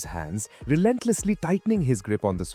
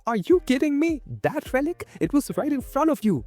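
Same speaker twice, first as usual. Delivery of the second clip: high-pitched disbelief; shocked, very high outburst; exclaiming; 12 semitones higher than usual. A narrator's reading. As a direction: exclaim incredulously.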